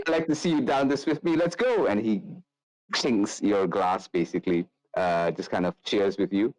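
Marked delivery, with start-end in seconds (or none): 0.0-1.9 higher, fast, eager dare; drinking challenge. Acted. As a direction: egg them on to drink.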